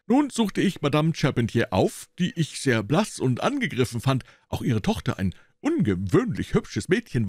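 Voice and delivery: boastful detective. A narrator's reading. Gregson telling Holmes how he cracked it. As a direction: brag about your cleverness. Gregson continues his account.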